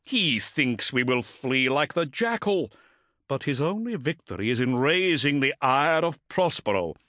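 proud commander's solemn oratory; intoning. A narrator's reading. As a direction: intone it grandly, drawing out the words. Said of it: "Motep intoned".

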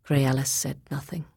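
ordinary delivery, nothing marked

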